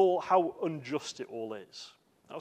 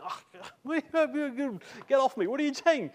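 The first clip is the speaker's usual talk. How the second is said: higher-pitched, exasperated outburst; annoyed protest; 9 semitones higher than usual. Acted. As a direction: act out being fed up with someone. Acting out annoyed reaction to toe-treading.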